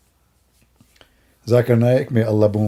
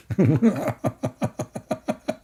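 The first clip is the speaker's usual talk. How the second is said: chuckle; 6 dB quieter than usual. Real, not acted. Speaker laughing at own joke, soft chuckle. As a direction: chuckle softly to yourself. Laughs after saying he's joking.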